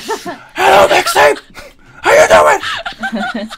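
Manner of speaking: mock shouted whisper; imitating a booming whisper greeting; stage whisper. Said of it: "Cole was like".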